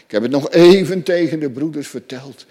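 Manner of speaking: ordinary delivery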